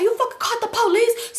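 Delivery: high, laughing mock yell; angry accusation. Man accusing the motel guy.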